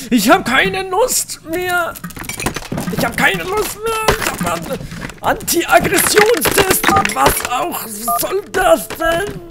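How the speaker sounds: high, slow ranting yell; mock rage. Surgeon yelling at the wobbly bottle, hammed-up rage. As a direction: blow up in hammed-up fury. Comic rage after anti-aggression claim.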